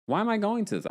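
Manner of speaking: high indignant mock question; high mock whine. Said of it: "Like, why am I going to this?".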